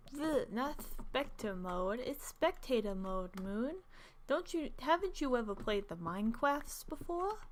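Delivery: mock correction in a put-on voice; humiliating self-mocking voice. Gamer scolding herself over a slip, self-mocking voice. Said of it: "do a hu-, humiliating voice of myself".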